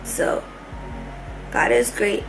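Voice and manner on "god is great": ordinary talk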